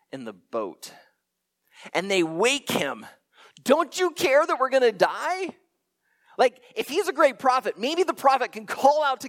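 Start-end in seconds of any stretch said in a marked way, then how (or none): 3.6-5.6 higher, louder frantic cry; panicked plea. Retold or imitated. Disciples shaking Jesus awake in the storm, panicked. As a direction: wake him up in a panic.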